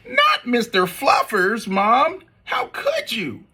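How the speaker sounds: put-on high, slow wail of a kid; whiny child pleading. Spencer begs his mom to spare the bunny, whiny high plea. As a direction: plead like a heartbroken little boy.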